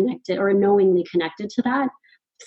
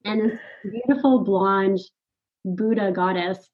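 ordinary talk

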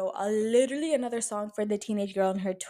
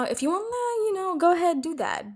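mocking; 10 semitones higher than usual. Mock permission to date men.

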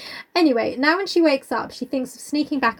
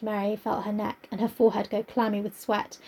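usual speaking voice

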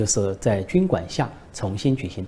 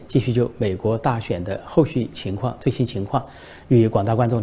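normal talking voice

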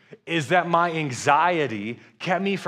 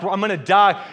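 louder, higher mock despair; retold panic; 4 semitones higher, 6 dB louder than usual. Six-year-old sure the plane is crashing, mock panic. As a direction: blurt it like a child in exaggerated panic. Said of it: "I'm so nervous".

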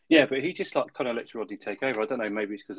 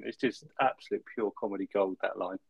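normal talking voice

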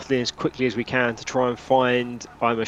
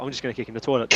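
ordinary delivery

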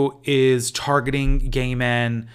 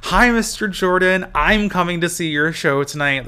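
playfully formal, higher and louder greeting; joking; 6 semitones higher, 4 dB louder than usual. Retold or imitated. Fan jokingly greets Leslie Jordan, playful. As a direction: tease him with a mock-polite hello. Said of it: "just kinda joking".

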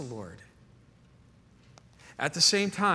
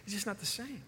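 soft wistful repeat; 6 semitones higher, 11 dB quieter, faster than usual. Retold or imitated. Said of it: "He goes".

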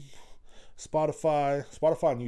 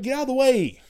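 retold yell; 11 semitones higher, 5 dB louder than usual. Retold yelling at shopper.

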